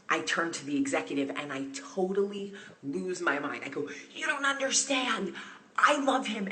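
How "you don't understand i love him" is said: gushing fangirl. Retold or imitated. Gushing to executive about Lionel.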